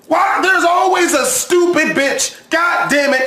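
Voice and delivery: furious yell; angry outburst. Real, not acted. Angry outburst at crying girl.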